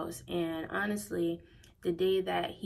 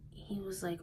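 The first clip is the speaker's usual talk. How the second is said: ordinary talk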